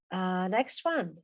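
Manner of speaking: ordinary delivery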